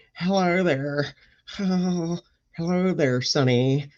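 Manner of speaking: lightly voiced creaky old man. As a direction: play a doddering grandpa greeting. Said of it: "see me in my spectacles".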